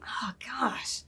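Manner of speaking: a defeated whisper of disbelief; exasperated whisper. Real, not acted. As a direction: breathe it out under the breath. Exasperated aside.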